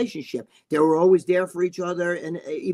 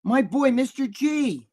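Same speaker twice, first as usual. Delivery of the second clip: high, drawn-out happy hail; real pleasure, slow and high-pitched; delighted greeting; 7 semitones higher, slower than usual. Real, not acted. Greeting a regular as he joins.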